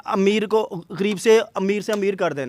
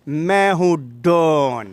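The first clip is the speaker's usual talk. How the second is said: slow, lower, punchy Don dialogue; dramatic punchy hero lines; 3 semitones lower, 3 dB louder, much slower than usual. Acted. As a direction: hit it like a film villain. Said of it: "ये जो फिल्मी dialogue है".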